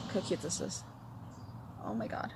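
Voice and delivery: breathless whisper of delight; whispered awe. Real, not acted. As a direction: whisper it, amazed. Admiring a cute stitch marker.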